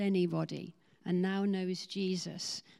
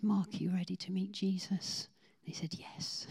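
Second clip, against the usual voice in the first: soft unvoiced whisper; gentle hushed question; 4 dB quieter than usual. Pastor asks Mark to meet Jesus, whispering. Quiet prayer moment.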